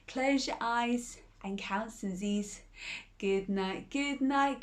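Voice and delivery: ordinary delivery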